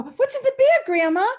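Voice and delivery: put-on high, loud child's question; curious child. Child asks grandmother, curious.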